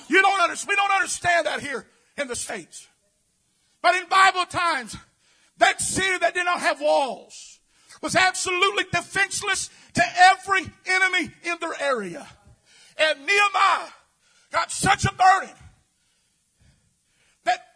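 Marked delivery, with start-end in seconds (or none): none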